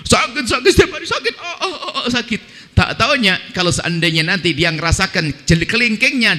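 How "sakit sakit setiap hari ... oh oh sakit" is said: high, laughing mock moan of a sick person; half-laughing imitation of a whiner's moan; mock whining patient. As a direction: ham up a complainer groaning about pain. Preacher mimics a whining sick person.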